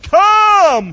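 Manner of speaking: loud, high, drawn-out call. Acted. Jesus calls Peter out of the boat, loud calling out. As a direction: boom out the summons. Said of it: "he says".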